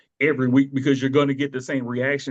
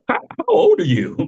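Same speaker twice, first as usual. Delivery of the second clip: high, loud mock disbelief; incredulous; 14 semitones higher, 6 dB louder than usual. Podcaster repeating his reaction to a young caller, incredulous. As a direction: jump up high and loud, astonished.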